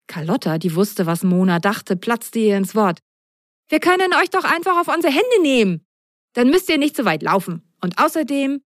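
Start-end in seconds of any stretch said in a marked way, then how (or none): 3.7-8.6 put-on higher, bright young girl voice full of ideas; a lively little girl with a plan; excited girl. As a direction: pitch it up like an eager child. Carlotta voiced in story.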